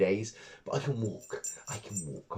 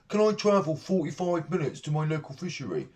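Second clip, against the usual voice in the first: lightly voiced dim asker, higher; a hint of a clueless angler asking, pitched up; mock silly question; 6 semitones higher than usual. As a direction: ask it like a gormless forum poster. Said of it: "they ask me the stupidest questions".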